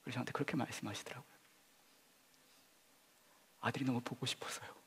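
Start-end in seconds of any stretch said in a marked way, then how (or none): 3.6-4.8 soft grieving quote. Retold or imitated. Quoting grieving mother.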